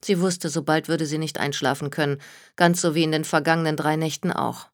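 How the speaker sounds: ordinary delivery, nothing marked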